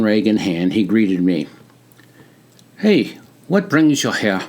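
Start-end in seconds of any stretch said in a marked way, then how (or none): 2.8-4.4 put-on higher, crisp British-like accent; friendly greeting in a British-like accent; a soldier greets a visitor in a British-like accent. A narrator's reading. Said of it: "in his crisp, British-like accent".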